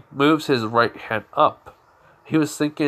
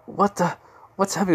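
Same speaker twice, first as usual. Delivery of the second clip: higher, startled cry of confusion; 7 semitones higher, faster than usual. Acted. Izuku feeling something strange in his chest, startled and higher. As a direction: blurt it out, caught off guard. Izuku feels power surge inside.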